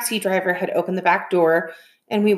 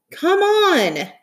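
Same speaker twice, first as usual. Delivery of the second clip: high, pleading call; urgent plea; 12 semitones higher, 4 dB louder than usual. Acted. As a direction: beg him to come. Sister urging, crying.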